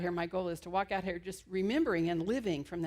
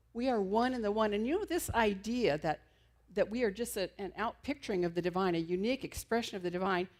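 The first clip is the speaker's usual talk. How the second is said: usual speaking voice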